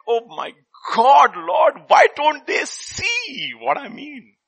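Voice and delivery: high, loud exasperated cry to God; exasperated Jesus. Retold or imitated. Preacher voicing Jesus, frustrated with the crowd. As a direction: throw up your hands in exasperation. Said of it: "he's like".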